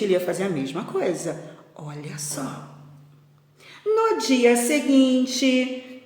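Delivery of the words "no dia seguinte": very slow, higher storyteller's opening; drawn-out picture-book narration; storytelling. A narrator's reading. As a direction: stretch it out to open the next scene. Resumes reading the story aloud.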